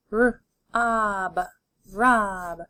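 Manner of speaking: d, ot, dot, parts pulled apart then joined; D, ot, dot: each part separate, then the whole word; segmented then blended. Shown as a demonstration. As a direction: sound it out and blend it. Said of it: "Let's keep going".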